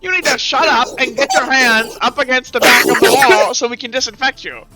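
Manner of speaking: loud, high-pitched command; acted shouting reprimand; angry plague doctor. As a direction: order them to comply, out of patience. Plague doctor orders kid to comply.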